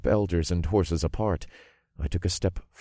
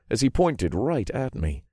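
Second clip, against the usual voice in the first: ordinary delivery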